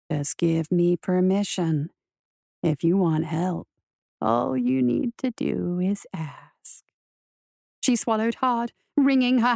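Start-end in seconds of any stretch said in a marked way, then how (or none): 0.1-6.8 purring demon voice. A narrator's reading. Voice offers to take her pain away, slow purr. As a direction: coax her low, stretching every word. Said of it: "the voice purred".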